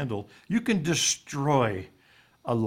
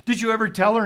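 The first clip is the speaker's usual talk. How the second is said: higher and louder, pressing; emphatic repeat; 7 semitones higher, 5 dB louder, faster than usual. Retold or imitated. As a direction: press the question home. Repeats his question for emphasis.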